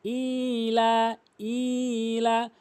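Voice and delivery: ইলা with ই and লা long; drawn-out ila. Shown as a demonstration. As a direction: stretch ইলা slowly. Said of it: "এক আলিফ টেনে পড়তে হবে".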